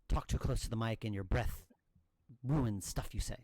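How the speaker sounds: popping breath, mouth right on the mic; loud popped breaths into the mic; breath pops on the mic. Shown as a demonstration. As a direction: talk too close to the mic. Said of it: "breath pops meaning stuff like this".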